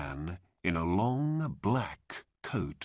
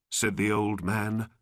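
ordinary delivery

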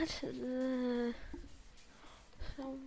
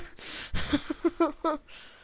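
breathy burst of laughter; laughing; 6 semitones higher, 6 dB louder than usual. Real, not acted. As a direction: laugh at the mess. Laughs at own failure.

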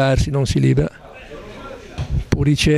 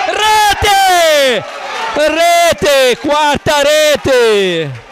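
high shouted goal call, last word drawn out; breathless italian goal commentary; 10 semitones higher, 4 dB louder, much slower than usual. Real, not acted. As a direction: yell the goal, stretch the final rete. Commentator calls fourth goal live. (urlo del gol lungo e concitato)

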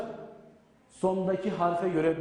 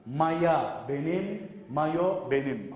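slow drill; 2 semitones lower, much slower than usual. Shown as a demonstration. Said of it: "Bunları ezberlemeniz lazım".

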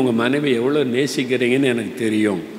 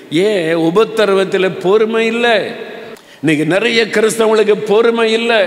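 fiery preaching; 5 semitones higher than usual. Acted. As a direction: thunder it at the congregation. Preaching at full force. (உரத்த பிரசங்கக் குரல்)

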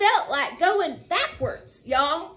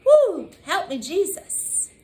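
usual speaking voice

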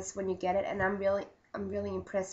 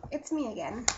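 ordinary talk, nothing marked

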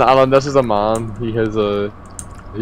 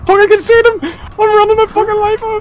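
being chased; 4 dB louder than usual. Real, not acted.

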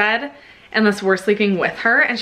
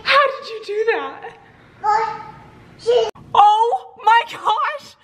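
high, tearful, disbelieving cry; almost crying with shock; 12 semitones higher, slower than usual. Real, not acted. Shocked mother watching camera footage.

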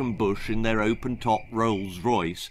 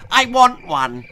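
demanding brat; 11 semitones higher, 8 dB louder than usual. Acted. Percy demands an elephant.

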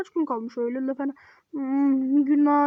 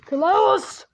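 put-on loud, higher dad bark at the kid; stern dad shout; 3 semitones higher, 3 dB louder, much slower than usual. Father yelling at the child Klaus, loud and stern. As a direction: shout like an angry father calling his son. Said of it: "baba moduna geçeyim ki hani bağırayım".

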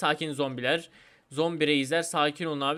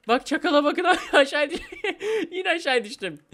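high, loud talk collapsing into laughs; breaking into laughter; 10 semitones higher, 5 dB louder than usual. Real, not acted. Player falling while pointing out a cheater, cracking up. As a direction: laugh helplessly through the words, high-pitched.

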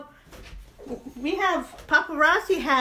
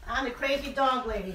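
ordinary talk

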